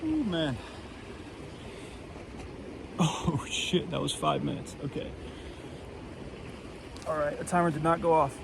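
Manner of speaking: breathless and shivering after icy plunge; in ice cold water. Real, not acted. Just out of winter cold plunge.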